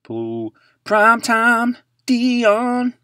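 high, loud, slow drawn-out announcement; hyped intro. Pretend. Host hyping a Deion Sanders card, slow high announcement. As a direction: introduce him like a star.

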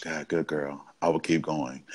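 put-on low, near-whispered good girl; hushed praise. Dominant praises partner in near-whispered low tones. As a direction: breathe the praise softly.

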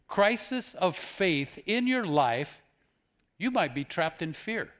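ordinary delivery

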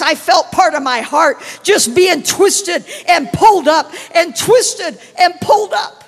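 loud, high, building to a shout; impassioned preaching. Real, not acted. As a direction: cry it out from the pulpit. Preaching climax, heart twisted.

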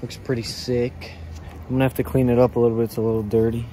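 normal talking voice

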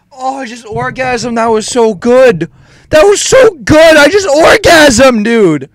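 high voice building to a shout; ecstatic gloating. Real, not acted. Gamer reveling in his hit, ecstatic gloating. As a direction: build from high squeal to loud yelling.

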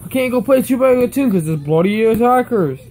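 fast, heated gamer rant; angry rant. Real, not acted. Kid complaining to Roblox about hackers, fast and angry. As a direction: spit it out quickly, fed up with hackers. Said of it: "It's making me so mad".

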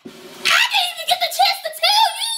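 high shrieking laugh, half crying. Real, not acted. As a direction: scream it out through laughter. Shouting laughing at the text.

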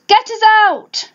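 high mock yell for rescue. Stuck family calling out, high mock yell. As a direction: pitch it up like a crowd calling. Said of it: "they yelled".

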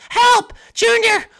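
very high call for Junior; high shout. Acted. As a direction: scream to be rescued. Toadette calling Junior.

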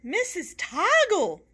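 high, loud, slow call; child yelling to the teacher; yelled. A narrator's reading. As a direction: shout her name across the room. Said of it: "yelled Joey".

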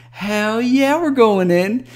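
high, loud excited whoop. Acted. Friends finding a secret room, excited. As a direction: cry it out, all fired up. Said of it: "He said excitedly".

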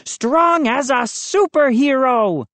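high, put-on superhero boast; boastful bellow. A narrator's reading. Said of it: "he bellowed".

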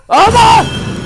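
sudden high yelp of surprise; startled yelp. Real, not acted. Startled by the monster, a high yelp. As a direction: jump out of your skin, high and loud.

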